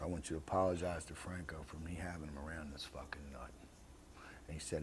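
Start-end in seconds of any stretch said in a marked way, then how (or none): 0.0-3.5 whispered, confiding low; quietly confiding to the boss in a whisper; hushed whisper. Retold or imitated. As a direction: tell the boss in a secret whisper. Boss quietly apologizing through Chapo.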